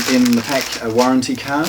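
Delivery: ordinary talk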